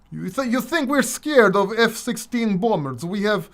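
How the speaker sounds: a hint of a boastful Russian, mocking; mock Russian accent. Mocking Russians to his channel viewers in a light Russian accent. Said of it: "making fun of the Russian as well".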